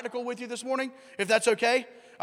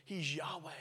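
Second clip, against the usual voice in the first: ordinary talk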